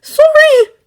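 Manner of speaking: usual speaking voice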